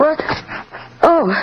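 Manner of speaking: sharp, high frightened oh; startled gasp. Acted. Doris startled when the Ripper appears behind her, sharp gasp. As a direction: jump at the sudden voice.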